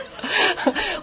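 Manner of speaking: bright real burst of laughter; high laugh. Real, not acted. Pastor laughing at the tongues method, high burst.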